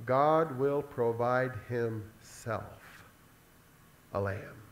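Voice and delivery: very slow, low, each word set apart; slow solemn emphasis. Acted. Preacher repeating Abraham's answer, slow and solemn. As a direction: let every word land with weight.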